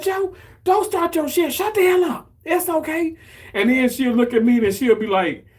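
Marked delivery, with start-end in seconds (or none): 0.0-3.2 high, bossy mom telling dad off; much higher, brushing him off; mom's voice. Retold or imitated. Mom snapping at dad Joe after the losses, high and bossy.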